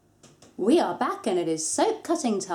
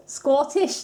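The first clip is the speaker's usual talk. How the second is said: one word in a hammed-up Scottish accent, higher and louder; bad Scottish accent; 6 semitones higher, 6 dB louder than usual. To her viewers, a playful bad Scottish accent. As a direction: throw in a jokey Scottish accent on Scottish, rising. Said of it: "that's my Scottish impression".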